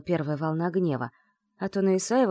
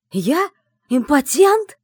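louder, higher, incredulous growl; growled through teeth; 9 semitones higher, 10 dB louder, much slower than usual. Acted. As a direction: snarl it through clenched teeth. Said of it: "прорычал сквозь зубы Исаев".

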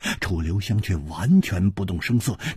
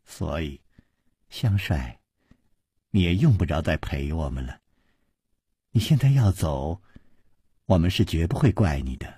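charming smile; 6 semitones lower, 3 dB quieter than usual. A narrator's reading. Liu Wumei smiling gently at Chu Liuxiang, lower and softer. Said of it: "嫣然一笑说".

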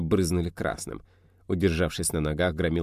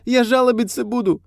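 high squealing complaint; whiny shriek; 7 dB louder than usual. A narrator's reading. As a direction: shriek the threat like a petty crook. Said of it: "взвизгнул подельник".

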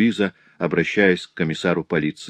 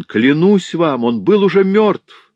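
desperate pleading; 6 semitones higher, 5 dB louder than usual. A narrator's reading. Louise swearing to her father the baby was dead, desperate plea. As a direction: beg them to believe you, near breaking. Daughter pleading innocence, distraught.

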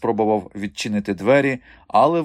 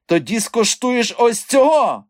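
high, loud, slow shout; furious threat; 13 semitones higher, 7 dB louder, slower than usual. A narrator's reading. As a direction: shout it while brandishing a whip. Madam threatens dwarf with whip. (лютий високий крик-погроза)

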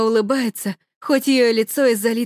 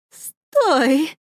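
trembling voice; 4 semitones higher than usual. Acted. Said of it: "зовёт мама дрожащим голосом".

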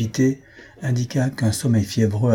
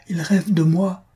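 higher-pitched, delighted realization; joyful discovery; 9 semitones higher, slower than usual. A narrator's reading. Young woman hearing her beloved murmur, joyful surprise. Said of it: "eut un mouvement de joie".

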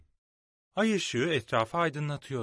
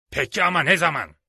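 loud, higher-pitched roar; impatient roar; 5 semitones higher, 10 dB louder than usual. A narrator's reading. The helmsman demands of Silver when, roaring impatiently. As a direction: roar the question at him. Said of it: "diye kükredi dümenci".

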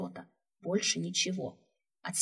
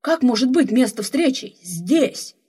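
alarmed question; 5 semitones higher, 10 dB louder, slower than usual. Acted. Technician baffled, alarmed.

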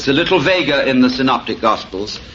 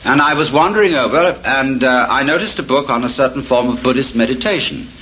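usual speaking voice

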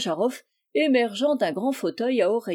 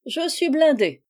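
cheerful; 6 semitones higher than usual. A narrator's reading. Messenger to the old lady, answering cheerfully. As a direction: answer brightly, undaunted. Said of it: "répondit gaiement le messager".

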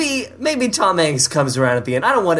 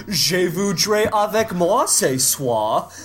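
put-on labored French accent, slowly drawn out; slow, hammed-up attempt at French; Tim Gunn; 3 semitones higher, much slower than usual. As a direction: try your best at a French accent, slowly. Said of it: "trying his best to do a French accent".

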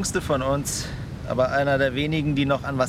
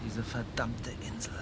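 hushed; 8 dB quieter than usual. Acted. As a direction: speak quietly, as if to yourself. Quiet talk about dying on island.

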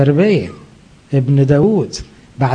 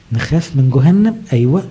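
normal talking voice

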